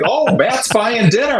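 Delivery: high, slow teasing singled-out announcement; mock teasing. Family hears the ka-ching at dinner, mock teasing.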